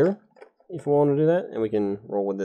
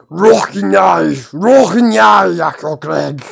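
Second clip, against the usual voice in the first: loud, high goblin shout; goblin yell; 7 semitones higher, 9 dB louder, much slower than usual. Acted. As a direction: bellow it out like a startled goblin. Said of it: "he yells out".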